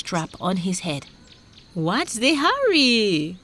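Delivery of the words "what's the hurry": higher, drawn-out question; lightly voiced leisurely fisherman; curious fisherman. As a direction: ask it slowly, pitched up. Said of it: "the fisherman asked".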